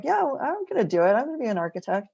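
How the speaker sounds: a touch of indignation; indignant. Retold or imitated. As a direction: insist like an offended kid. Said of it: "I was really indignant".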